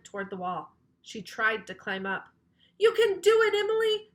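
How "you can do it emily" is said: high loud cheer; shouted encouragement. A narrator's reading. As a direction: cheer her on up the wall. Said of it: "shouted Andrea".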